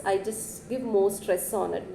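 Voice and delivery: normal talking voice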